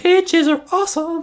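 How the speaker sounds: very high squeaky voice. Pretend. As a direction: squeak it out silly.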